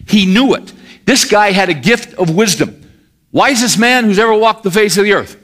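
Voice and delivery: high, loud pulpit delivery; forceful preaching. Real, not acted. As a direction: drive the point home to the congregation.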